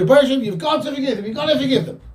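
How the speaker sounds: high, quick insistent plea; changed, demanding tone. Retold or imitated. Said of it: "changes completely his tone".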